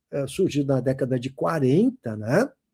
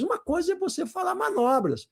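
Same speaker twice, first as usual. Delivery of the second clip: ordinary talk, nothing marked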